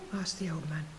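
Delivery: plain reading voice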